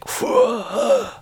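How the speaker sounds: high gasp like a dying Emperor; long, strangled demonstration gasp; dying-villain gasp. Shown as a demonstration. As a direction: gasp as if bitter beer hit you. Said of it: "You sound like Emperor Palpatine dying".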